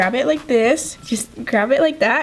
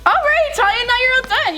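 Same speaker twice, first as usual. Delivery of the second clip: cheery announcement; 12 semitones higher than usual. Real, not acted. Telling Talia she is done, cheery high voice. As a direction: announce it brightly, pitched way up.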